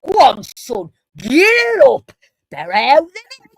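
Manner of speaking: high, loud, stretched-out egging on; hammed-up yell of drunken encouragement; mock cheering on. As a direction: cheer a mate on, voice swooping right up. Drunk friend cheers on vomiting mate.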